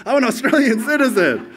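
high and loud with a laugh, gushing; mock proud citizen. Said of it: "Did you wake up yesterday".

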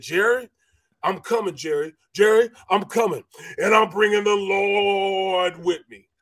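sing-out threat that swells on the last line; Reggie's warning. Retold or imitated. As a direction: call out a mock-ominous warning, building to a preacher-like finish. Acting out Reggie's warning before the snap.

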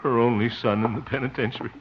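low, soft, mournful brooding; dejected self-pity. Acted. Harold laments letting the old folks down, low and dejected. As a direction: mope aloud as if all is lost. Despairing monologue after failure.

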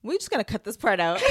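ordinary talk, nothing marked

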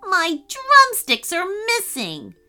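high, distressed complaint; panicked elf bandmate; dismayed outcry. Acted. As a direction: cry out in dismay at a loss. Bandmate finds his drumsticks gone.

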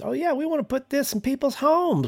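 high, put-on Southern twang; sarcastic Southern impression, pitched high; mock Southern accent. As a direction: ham up a folksy Texan salesman. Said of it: "Even the way he says this".

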